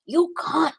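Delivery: high, prissy mock insult; imitating her insult. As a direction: spit it like she does.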